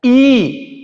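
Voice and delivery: ई held long; teacher demonstrating the long ई; long ee demo. Shown as a demonstration. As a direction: stretch ई over two beats. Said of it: "अब ई भी तालव्य है और दीर्घ है".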